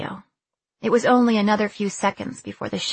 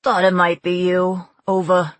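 loud Brooklyn radio officer; Brooklyn accent; 7 dB louder than usual. A narrator's reading. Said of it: "The accent was pure Brooklyn".

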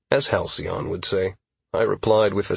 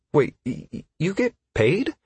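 stammering surprise; 7 semitones higher than usual. Acted. Startled stammering question.

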